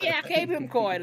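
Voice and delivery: high, loud mock cheer; cheering crowd. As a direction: hype it up like the crowd. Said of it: "They're like".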